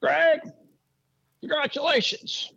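mentor's voice. Retold or imitated. Mentor phoning the new track boss with a warning, high and weighty. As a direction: play the older mentor laying down the stakes.

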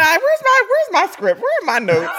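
high, loud flustered scramble for notes; searching for your notes in a panic. Real, not acted. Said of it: "now you are flustered".

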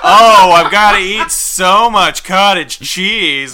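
slow, loud, high whining with a laugh; whiny mockery. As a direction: whine it like a spoiled complainer. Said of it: "total whiny bitch".

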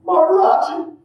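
high, loud blissful Maharaji; ecstatic answer. Retold or imitated. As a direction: cry out the name in bliss. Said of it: "I was like".

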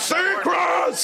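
a high, gleeful shout of Seacrest. Pretend. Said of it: "I feel like I shout that several times".

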